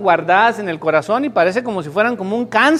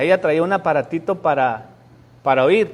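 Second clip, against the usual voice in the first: ordinary talk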